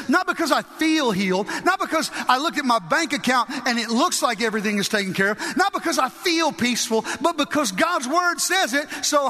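high-pitched, driving exhortation; impassioned pulpit declaration; fervent preaching. Real, not acted. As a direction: hammer each reason with conviction. Preaches at full force.

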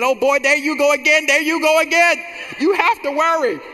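high, loud, exasperated mother; mama's exasperated voice. Retold or imitated. Mother scoffs at her son's no-worry talk, high, loud exasperated voice. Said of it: "She said".

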